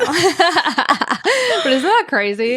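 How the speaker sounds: slow, loud words through laughter; laughing. Real, not acted. Telling a cohost about a wedding, laughing. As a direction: laugh it out slowly.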